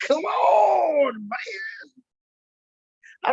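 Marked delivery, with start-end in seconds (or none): 0.1-2.0 frustrated cry out. Real, not acted. Frustrated fan yelling at game.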